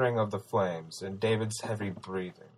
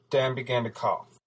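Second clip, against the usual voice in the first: ordinary delivery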